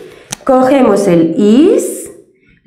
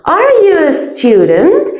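"Are you a student?" lifted higher, with a rise on "Are"; slow, higher, rising question tune; 7 semitones higher than usual. Shown as a demonstration. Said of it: "y ya entono".

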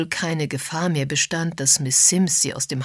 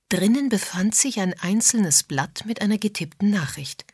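ordinary delivery, nothing marked

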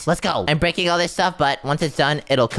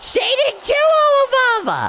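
panicked shout, pitched way up; 4 dB louder, slower than usual. Real, not acted. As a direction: yell the order in alarm. Ghost spiders swarm the bunker.

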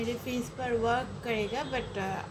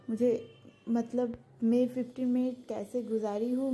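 ordinary talk

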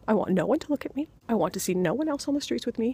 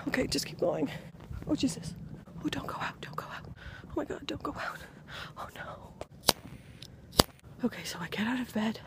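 scared hushed whisper; breathless nighttime whisper; 3 semitones higher, 8 dB quieter than usual. Real, not acted. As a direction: whisper so nobody outside hears. Still creeping outside, whispering.

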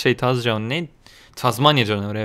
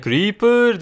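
meme voice; 7 semitones higher than usual. Retold or imitated. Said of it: "bu seride sürekli şey diyordum böyle".

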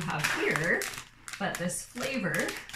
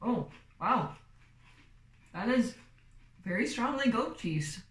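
speaking between bites; chewing while talking; 2 semitones higher than usual. Real, not acted. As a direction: mouth partly full of chip. Seen 0: Woman bit a chip at -5; pursed, working mouth while speaking.

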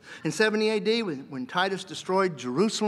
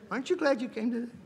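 laughing; 6 semitones higher, 4 dB quieter, faster than usual. Shown as a demonstration.

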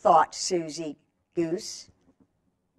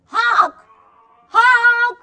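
high, louder goose honks; 9 dB louder than usual. Shown as a demonstration. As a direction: honk out loud with the kids. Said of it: "Can anybody do honk?".

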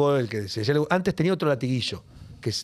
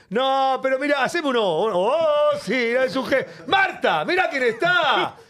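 excited fan; 9 semitones higher, slower than usual. Retold or imitated. Fan calling to his wife Marta, gushing and high. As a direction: gush over a celebrity and call the wife over.

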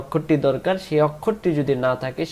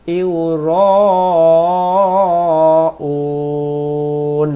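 slow, drawn-out tajweed chant; Quran recitation; 6 dB louder, much slower than usual. Acted. Teacher reciting the verse slowly for students. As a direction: hold সাহুন very long.

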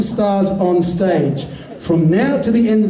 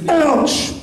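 high, abrupt cry of pain; sudden high-pitched exclamation; sudden mock ouch; 8 semitones higher than usual. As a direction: yelp it as a trigger word. Fires the hypnotic trigger word.

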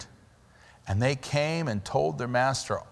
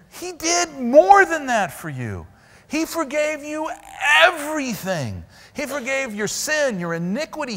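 higher, impassioned preaching; full-force preaching; 9 semitones higher than usual. Real, not acted. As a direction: drive the point home to the congregation.

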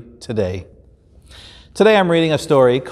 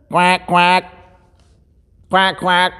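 quacking higher duck voice; 5 semitones higher than usual. Acted. Duck telling the shepherd where the lamb went. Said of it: "says the duck".

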